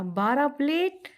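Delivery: slow, high disbelieving question; shocked disbelief. Acted. Husband hears she needs twelve plates, stunned slow question.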